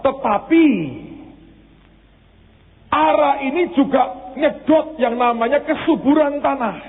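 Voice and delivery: high, ringing preacher's voice; a pastor lifting his voice over the church; fervent preaching. Acted. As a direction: preach it out to the congregation. Preaching on God's promise.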